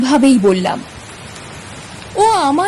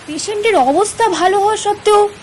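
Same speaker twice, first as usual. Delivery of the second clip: higher-pitched, puzzled questioning; astonished; 6 semitones higher, 3 dB quieter than usual. Acted. Narrator questions his doctor friend about the patient, astonished. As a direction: question it in surprise, pitch climbing. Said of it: "আমি অবাক হয়ে বললাম".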